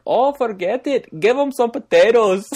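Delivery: loud, high-pitched hammed-up Irish accent; botched Irish accent. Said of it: "not Irish".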